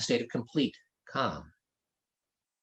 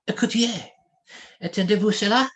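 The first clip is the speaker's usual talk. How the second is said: higher, eager French exclamation; exclaiming; 8 semitones higher than usual. Acted. Prince urging the others to listen to chanting, exclaiming. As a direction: call out in surprise, listening. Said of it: "he exclaimed".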